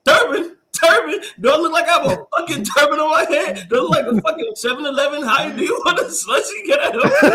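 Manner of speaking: high, loud, fast retold protest; mock indignant outburst.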